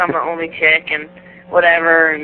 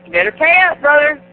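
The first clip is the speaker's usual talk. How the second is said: high cocky taunt; gloating; 8 semitones higher, 3 dB louder than usual. Retold or imitated. Demanding her bet winnings, high gloating taunt. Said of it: "And then I was like".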